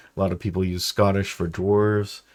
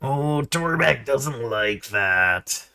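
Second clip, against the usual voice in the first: high, slow third-person brute; slowly, pleased with himself, higher; Torbeck voice; 6 semitones higher, much slower than usual. Retold or imitated. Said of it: "I don't know if I can do his".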